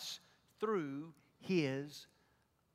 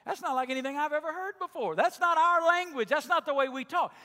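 puzzled; 8 semitones higher, 7 dB louder than usual. Retold or imitated. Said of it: "you'd go".

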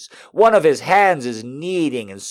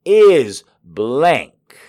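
climactic; 5 semitones higher, 6 dB louder than usual. A narrator's reading. Narration climax peak, loud.

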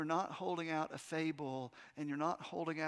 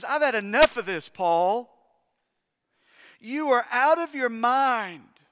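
loud, furious outburst at Paul; angry loud shout; 3 semitones higher, 11 dB louder than usual. Acted. Governor shouting at prisoner Paul, furious and loud. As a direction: bark it at him like an angry governor. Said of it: "He interrupts Paul in an angry, loud voice".

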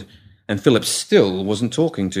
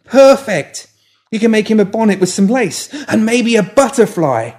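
higher, louder delighted squeal; excited; 12 semitones higher, 7 dB louder than usual. A narrator's reading. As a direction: bubble over with delight. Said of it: "Molly's eyes lit up".

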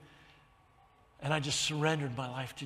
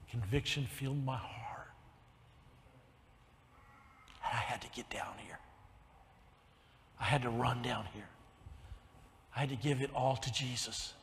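soft and low, nearly whispered in places; hushed, moved testimony; 4 semitones lower, 8 dB quieter than usual. Real, not acted. Pastor tells congregation of surrendering to Jesus, hushed and moved. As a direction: lower the voice to a reverent hush. Emotional testimony of his conversion.

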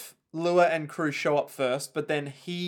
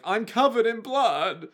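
high, slow whimper of a line; lightly voiced scared kid; mock Jacuzzi; 7 semitones higher than usual. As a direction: say it slowly and pitifully high. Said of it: "he'll be all like".

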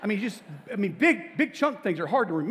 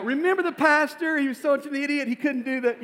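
higher, louder imagined guest laughing at the pastor; imagined anniversary guest mocks the pastor, higher and louder; mocking wedding guest; 9 semitones higher, 6 dB louder than usual. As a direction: play a guest sneering at the pastor years later. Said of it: "my biggest fear is that I'm gonna be the joke".

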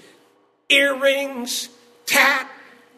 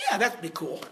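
low, soft, offhand slacker reply; too-cool son; 8 semitones lower, 9 dB quieter than usual. Retold or imitated. Slick-haired son answering his dad, low and offhand. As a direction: toss it off like a cool kid.

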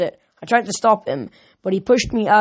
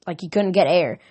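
usual speaking voice

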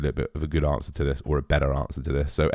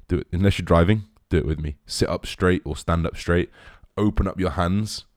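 ordinary talk, nothing marked

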